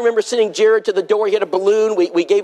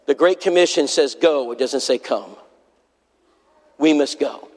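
solemn; 6 semitones lower than usual. Acted. Solemn low close of appeal.

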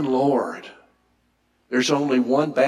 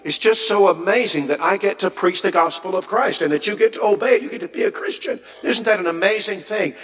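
much higher, rising with wonder; excited apostle; 8 semitones higher than usual. Retold or imitated. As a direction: gush with amazement at preaching from prison. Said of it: "here he is from prison, and he's saying".

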